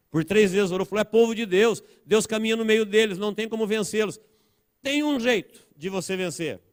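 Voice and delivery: high, urgent preacher's retelling; impassioned preaching. Real, not acted. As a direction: tell the Bible story at sermon pitch. Preaches the story forcefully.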